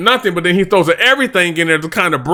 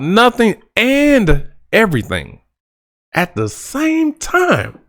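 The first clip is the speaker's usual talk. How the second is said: drawn out; 5 semitones higher, slower than usual. Shown as a demonstration. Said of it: "just put that into perspective".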